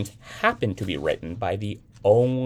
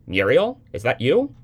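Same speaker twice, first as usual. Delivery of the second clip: a fretful mother calling, pitched up and louder; anxious mother; 6 semitones higher, 7 dB louder than usual. A narrator's reading. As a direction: voice the worried mother on the line.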